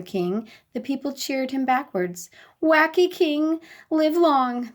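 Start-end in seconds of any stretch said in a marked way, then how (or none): 2.6-4.7 a lightly voiced happy crowd cry, higher; happy cheer. A narrator's reading. People cheering the king backwards, mildly happy. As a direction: cheer it out gladly. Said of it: "they cried happily".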